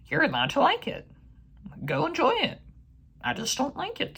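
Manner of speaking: high, sing-song teasing voice; mock sweet. Reviewer teasing fans who liked the sequel, in a high mock-sweet voice. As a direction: coo it like you're humouring a child.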